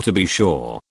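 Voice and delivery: lightly mocking cartoon Irish lilt on "to be sure"; mock stage-Irish accent. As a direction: tease the leprechaun Irish accent a little. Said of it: "the cartoon accent that goes along with it".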